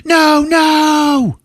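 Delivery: high loud shout; screaming no. Retold or imitated. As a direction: scream as the baby is carried off. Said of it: "she's fucking screaming".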